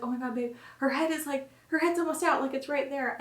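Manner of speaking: put-on husband seeing the baby crown, amazed; excited husband. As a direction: voice him thrilled and disbelieving. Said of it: "he was like".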